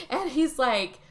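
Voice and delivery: usual speaking voice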